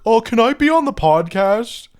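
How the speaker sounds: high, loud pleading imitation; mock eager fan. Podcast host mimicking people in DMs, mock eager fan.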